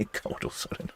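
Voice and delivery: whispered through a giggle; half-laugh the name under the breath; breathy laughing whisper. Real, not acted. Repeating a viewer's silly name in a giggling whisper.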